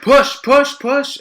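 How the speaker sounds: loud, high repeated command; urgent nurse. Retold or imitated. Nurse to mother in labour, urgent. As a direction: imitate a nurse urging a push. Acting out nurse ordering the push.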